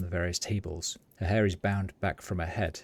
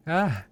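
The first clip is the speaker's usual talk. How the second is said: startled shriek, higher and louder; piercing shriek; 9 semitones higher, 6 dB louder than usual. Acted. Startled seamstress shrieks at the waking corpse. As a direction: shriek as the seamstress jumps away. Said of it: "gives a piercing shriek".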